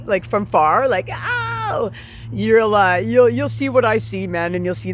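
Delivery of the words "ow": 1 mock yelp. Mocking neighbour waving from afar.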